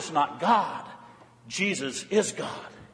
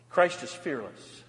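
usual speaking voice

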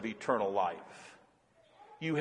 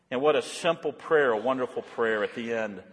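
ordinary delivery

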